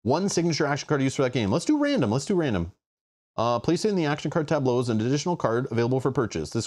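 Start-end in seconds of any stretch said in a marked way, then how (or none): 1.5-2.1 much higher playful squeak; squeaky excited. Pretend. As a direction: chirp it out excitedly. Excited squeak then plain repeat.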